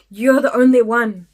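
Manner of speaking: loud, emphatic mock driver; a near-shout of recognition; excited exclamation. Bus driver greets the only witness with a near-shout. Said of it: "he would just be like".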